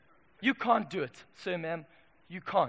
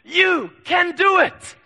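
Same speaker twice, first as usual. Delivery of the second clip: mocking a hyped motivational speaker, higher, loud and slow; hyped-up yell; 8 semitones higher, 10 dB louder, much slower than usual. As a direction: ham up a stage speaker's shouted pep talk. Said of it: "a hyped up young man somewhere yelling".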